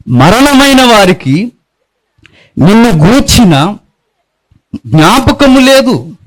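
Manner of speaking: slow, high, drawn-out scripture reading; psalm recitation. Acted. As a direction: intone the psalm slowly like a lament.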